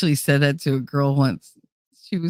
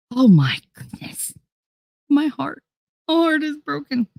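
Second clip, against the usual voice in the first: high, hammed-up heartbroken; mock heartbreak; 10 semitones higher than usual. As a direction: play the wounded heart.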